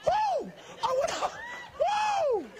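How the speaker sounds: squealing, giddy from the fumes. Real, not acted. High on polyurethane fumes.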